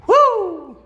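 high loud whoop of triumph; victory whoop. Real, not acted. Celebrating another win with a loud high whoop. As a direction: let out a big celebratory woo.

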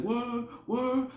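high, softish mock "word, word"; imitated listener's reply. Gullible man agreeing with the storyteller, imitated in a high pitch.

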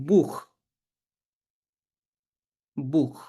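"বুখ" ending in a throaty kh; kh sound for CH. Shown as a demonstration. Teacher demonstrating CH after U, "বুখ" with kh. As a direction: end "বুখ" on a kh, not a sh. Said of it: "খ দিয়ে উচ্চারণ করব".

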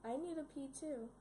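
ordinary talk, nothing marked

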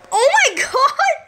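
high, loud exclamation; shocked outburst. Real, not acted. Player suddenly seeing a crime scene, shocked shout. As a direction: burst out in alarm, pitch shooting up.

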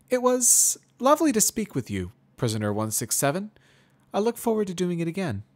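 high, loud, polite goodbye; polite mare farewell. Acted. Twilight says goodbye.

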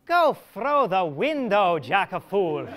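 loud, slow, mocking brush-off; scornful dismissal. Alisoun scolding Absalom away from her window, loud. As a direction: shoo him off with a sneer.

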